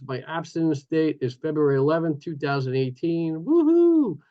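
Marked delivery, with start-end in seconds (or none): 3.5-4.2 high, loud joyful whoop; excited whoop. Real, not acted. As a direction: cheer your own date like a party. Celebrating his abstinence date.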